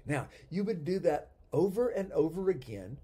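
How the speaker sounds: normal talking voice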